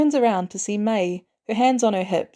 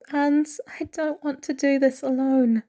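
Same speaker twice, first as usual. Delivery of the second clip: small, scared voice; 7 semitones higher than usual. A narrator's reading. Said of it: "Peter's voice gets really small and very scared".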